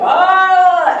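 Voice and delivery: loud high anguished "Oh"; panicked cry. Retold or imitated. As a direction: let out a loud high moan of worry. Friend panics about being late.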